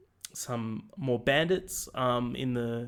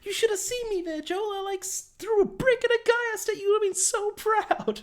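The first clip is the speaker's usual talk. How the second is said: very high put-on girl's falsetto; mock a boasting kid in squeaky falsetto; Ellie; 6 dB louder than usual. Ellie tells Joel what she did, mock falsetto boast. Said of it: "Ellie's like".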